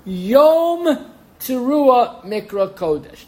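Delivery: Yom Teruah Mikra Kodesh read slowly, word by word; slow, spelled-out reading. Shown as a demonstration. Rabbi tells students to follow: slow, deliberate reading. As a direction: lay out each word slowly so they can follow. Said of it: "follow me here".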